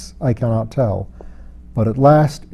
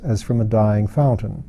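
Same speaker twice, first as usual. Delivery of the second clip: ordinary delivery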